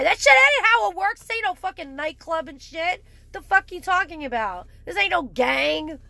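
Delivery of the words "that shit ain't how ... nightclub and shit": higher and louder outburst; angry shout. Real, not acted. Telling posers drugs aren't cool, angry shout. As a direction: snap back hard.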